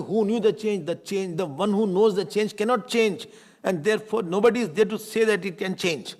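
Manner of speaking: high, loud, driving questions; a teacher building to a forceful climax; forceful preaching. Real, not acted. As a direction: press the class hard with each question.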